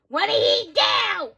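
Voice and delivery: normal talking voice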